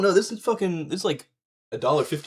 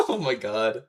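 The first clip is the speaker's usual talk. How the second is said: laughing; 3 dB louder, slower than usual. Real, not acted. Laughing at own failure.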